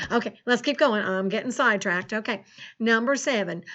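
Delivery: put-on folksy rural American farmer accent; Farmer Ted's country accent. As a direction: chat to the kids in a hammed-up country farmer accent. Plays Farmer Ted throughout lesson.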